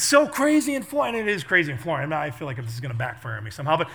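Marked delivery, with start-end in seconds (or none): 0.0-1.2 high, loud weatherman shouting over wind; hammed-up live storm report; mock storm reporter. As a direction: yell it like a reporter in a gale. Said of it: "the guy from the Weather Channel".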